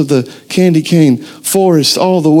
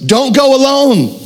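raised, high-pitched preacher's call; shouting; 8 semitones higher than usual. Retold or imitated. Preacher voicing God to the church, raised shout. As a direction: call it out as an urgent command. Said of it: "God is shouting to us".

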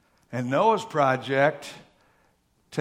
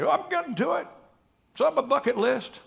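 high-pitched excuse-making; mock defensive; 13 semitones higher, 3 dB louder than usual. Owner answering nagging visitors, mock defensive. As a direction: protest in a high voice. Acting out his own defensive reply.

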